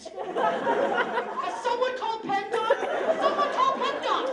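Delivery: high, loud cry for help; mock alarmed shout. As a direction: holler it out in hammed-up urgency. Passers-by call out in alarm.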